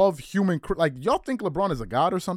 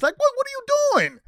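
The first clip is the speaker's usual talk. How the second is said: incredulous; 5 dB louder, faster than usual. Real, not acted. Incredulous outburst.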